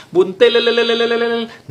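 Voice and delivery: high, loud, rippling trilled street call; buntil vendor's echoing cry. Retold or imitated. Buntil seller calling through the neighbourhood, echoing high cry. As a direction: roll the last syllable out like an echo. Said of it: "keliling suara khas tadi".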